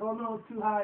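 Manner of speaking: mock alarm. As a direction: act out a worried refusal. Voicing a character refusing to jump.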